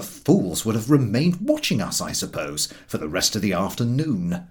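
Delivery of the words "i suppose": usual speaking voice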